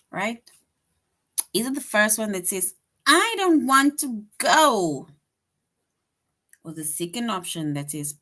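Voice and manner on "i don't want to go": emphatic exclamation. Shown as a demonstration. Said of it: "That shows strong feelings".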